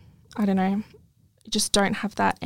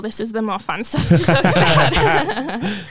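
laughing through the words, slower; 8 dB louder than usual. Real, not acted. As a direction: giggle while saying it. Laughs while plugging her business.

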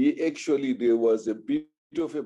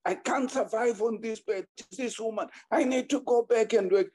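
indignant rant; 9 semitones higher than usual. Retold or imitated. Husband ranting about chickens.

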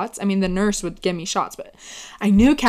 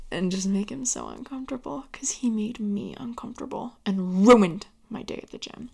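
unvoiced conspiratorial whisper; 5 dB quieter than usual. Real, not acted. Admitting to viewers she made him uncomfortable, whispered. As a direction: confess the petty revenge in a whisper. Petty revenge confession whispered.

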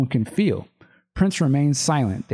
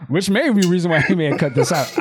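laughing; 3 semitones higher, faster than usual. Real, not acted. Laughing through the joke.

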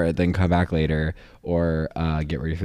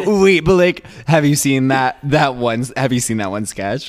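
higher, louder mock eager questioning; eager fans; 6 semitones higher, 7 dB louder than usual. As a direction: imitate excited people asking about the sketch. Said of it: "And they're like".

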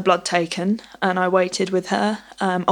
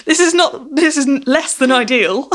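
high, louder, laughing through the words; answering mum's joke while laughing, pitched up; 7 semitones higher, 4 dB louder than usual. Retold or imitated. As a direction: giggle it out in disbelief. Laughing understatement about tumour.

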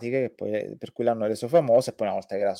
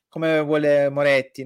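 ordinary talk, nothing marked

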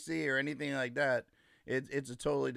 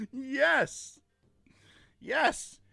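gleeful yes; 11 semitones higher, 4 dB louder than usual. Real, not acted. Gleeful shout.

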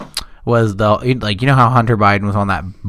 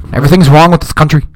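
imitating Bernie; 6 semitones higher, 6 dB louder, faster than usual. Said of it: "He talks like this. Much like this".

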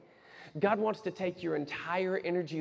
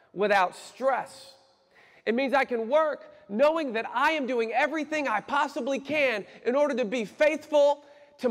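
raised, impassioned preacher cadence; forceful preaching; 7 semitones higher, 5 dB louder than usual. Real, not acted. Preacher urging church to work without worry, forceful preaching. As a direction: build it high and punch the key words.

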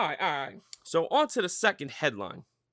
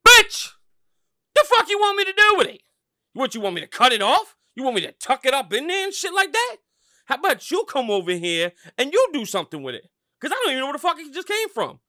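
screaming, much higher and louder; furious shout; 8 semitones higher, 10 dB louder than usual. Acted. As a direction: yell back in outrage. Acted angry reply to wife.

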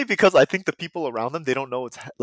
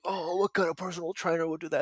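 higher, softer put-on complaint; mock triggered; 4 semitones higher, 6 dB quieter than usual. Viewer who took the prank seriously, mock triggered. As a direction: imitate an offended viewer, pitched up. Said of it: "people who got triggered by that".

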